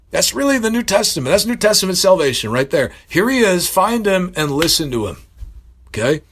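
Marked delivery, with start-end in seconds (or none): 0.1-5.2 high, impassioned preaching that keeps climbing; preaching at full force. Acted. Preacher urging listeners to hear Christ, impassioned and high. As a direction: drive the point home like a revival preacher. Preaching climax on heavenly calling.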